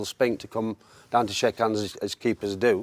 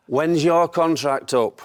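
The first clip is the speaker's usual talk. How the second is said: slow, drawn-out imitation of Brian Clough's accent; Brian Clough impression; 5 semitones higher, 5 dB louder, much slower than usual. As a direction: play Brian Clough lazily teasing, very slow. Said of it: "Such a great accent".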